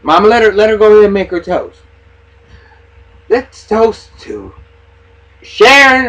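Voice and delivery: retold daughter mockingly toasting her mother; mock sarcastic toast. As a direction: ham up a snide toast to the mother. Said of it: "and A was like".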